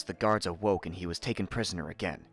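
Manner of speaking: usual speaking voice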